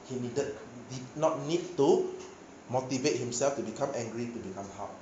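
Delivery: usual speaking voice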